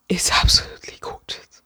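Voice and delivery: whispered, with a little laugh; awed whisper. Real, not acted. To viewers, hushed over the pretty book, whispering. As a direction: breathe it out in awe.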